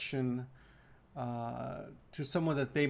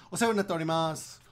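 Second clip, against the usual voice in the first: quick, natural; 2 semitones higher, 4 dB louder, faster than usual. Shown as a demonstration. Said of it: "quickly, because that's the way they say it".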